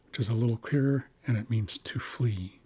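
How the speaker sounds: ordinary delivery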